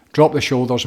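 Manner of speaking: calm instructor counting out breaths; guided breathwork. Shown as a demonstration. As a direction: lead them through each breath, unhurried. Guides the breathing exercise aloud.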